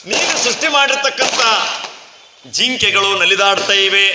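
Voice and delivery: Narada's lyrical praise. Acted. Sage praising his Lord's creatures on earth, declaiming. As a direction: proclaim each wonder as a storybook sage. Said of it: "ಎಷ್ಟು ಚಂದ ಹೇಳ್ತಾನೆ ನಾರದ".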